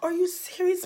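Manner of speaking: high-pitched retold astonishment; amazed disbelief. Asking her sister about their mom, amazed disbelief. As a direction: react in stunned, hopeful disbelief.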